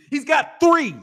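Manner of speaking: high laugh through the words; real laughter breaking the words. Real, not acted. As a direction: crack up mid-line.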